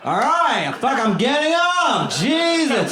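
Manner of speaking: high, slow, put-upon groan; lazy hummingbird. Pretend. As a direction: drag yourself up like a weary tiny bird. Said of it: "It's lazy".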